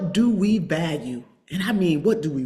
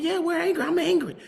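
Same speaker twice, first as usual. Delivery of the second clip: angry; 12 semitones higher than usual. Real, not acted. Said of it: "hell yeah, I'm angry".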